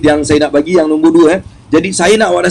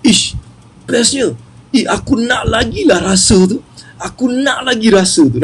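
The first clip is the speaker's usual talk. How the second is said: higher-pitched wish for more; retold eager delight; 5 semitones higher than usual. Speaker recalling why he began the program, eager delight. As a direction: relive the craving for that feeling. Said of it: "aku rasa macam".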